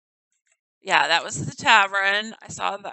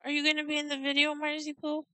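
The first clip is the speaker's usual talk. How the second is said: pet talk; 5 semitones higher, 7 dB quieter, faster than usual. Real, not acted. Owner asking her cat to be in the video, fast. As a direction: coo at the cat.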